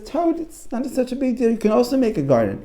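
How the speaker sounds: high-pitched, breezy story character; high cartoonish reassurance; storybook frog. Frog answering Toad in story.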